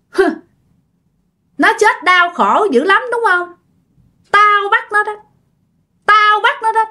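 high, menacing ghost voice; gloating vengeful ghost. Acted. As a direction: let the spirit gloat over its revenge. Vengeful ghost gloats through Ngọc. (giọng vong báo thù hả hê, cao)

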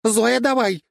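high, loud cheer; piercingly high supporter's yell; shouting. A narrator's reading. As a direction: call out to urge her on. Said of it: "кричала Жанна".

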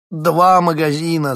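high, loud, slow wail of loss; lamenting shout, high and loud. A narrator's reading. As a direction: wail it like a ruined man. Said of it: "запричитал он".

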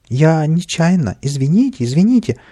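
high, pleading apology; fawning. A narrator's reading. Frightened little man apologises to the angry cat, fawning. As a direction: grovel in a lifted voice, stretching the first words. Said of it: "залебезил он".

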